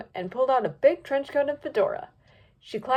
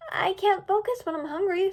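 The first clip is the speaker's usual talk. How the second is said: high, whiny complaint; Misty's voice; 9 semitones higher than usual. A narrator's reading. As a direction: pout it, pitched up.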